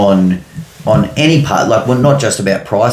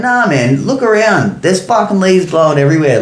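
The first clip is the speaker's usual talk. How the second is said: higher, cocky brush-off; smart-ass retort; 10 semitones higher than usual. Retold or imitated. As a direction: dismiss him like a smart ass. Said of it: "I was being a massive smart ass".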